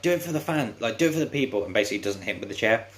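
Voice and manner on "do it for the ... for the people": higher, pleading taunt; urging taunt. Retold or imitated. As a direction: goad him on for the crowd. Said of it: "It's like".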